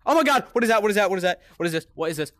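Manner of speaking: high, loud startled cry easing off; frightened repeated babble, high-pitched; panicked. Real, not acted. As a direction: rattle it out in alarm, starting loud. Sudden fright in horror game.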